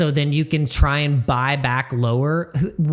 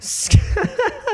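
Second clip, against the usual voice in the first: a squeaky, breathless laugh; sudden high cackle at a chat joke. Real, not acted. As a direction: burst of high-pitched laughter.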